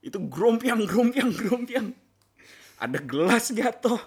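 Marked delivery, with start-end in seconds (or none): 0.0-1.9 high, loud, drawn-out clanging words; imitated clattering. Prankster describes the room falling apart, imitated clatter. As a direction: make each word crash like falling pans.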